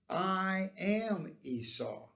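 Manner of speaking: slow, weighty declaration on "I am"; emphatic I AM. Acted. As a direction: stretch "I am" with deliberate weight. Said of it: "to emphasize, "I am." This was of special emphasis".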